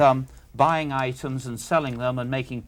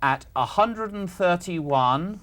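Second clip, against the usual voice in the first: slow, higher, held-open number; slow dictation; 5 semitones higher, slower than usual. Real, not acted. Questioner dictating the selling figure, slowly. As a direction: read the figure out unhurriedly, more to come. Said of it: "I'll say it slowly".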